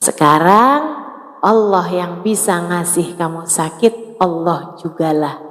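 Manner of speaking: lightly voiced mother soothing her child. Mother comforting a hurting child. (ibu menenangkan anak dengan lembut dan pelan)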